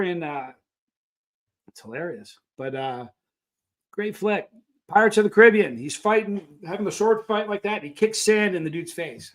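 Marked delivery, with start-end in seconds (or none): none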